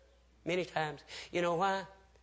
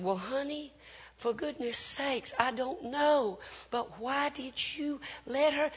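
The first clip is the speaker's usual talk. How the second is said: higher, softer, slow pleading reproach; exasperated husband; 6 semitones higher, 9 dB quieter, slower than usual. Retold or imitated. Husband questions wife about the child's fall, softly exasperated. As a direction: imitate a husband chiding his wife, drawn out.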